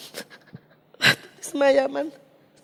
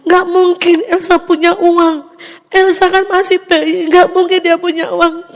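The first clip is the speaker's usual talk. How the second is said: louder, insistent mother's denial; retold disbelief; 3 semitones higher, 6 dB louder than usual. Mother doubting her son about baby Elsa's money, loud disbelief. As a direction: firmly insist it cannot be so.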